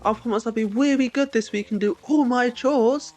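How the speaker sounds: put-on high, whiny little kid begging mum; pleading child. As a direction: beg like a small child asking for money. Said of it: "Do you have to turn around and go".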